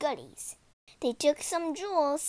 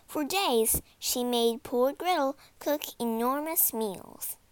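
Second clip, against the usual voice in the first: usual speaking voice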